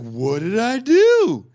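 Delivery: high, loud, drawn-out wail of innocence; bewildered protest. Retold or imitated. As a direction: imitate an indignant, baffled victim. Punched man protests.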